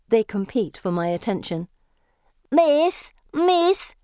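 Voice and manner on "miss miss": put-on very high, loud child's call; children crying out. A narrator's reading. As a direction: call for her attention like begging children. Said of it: "they cry".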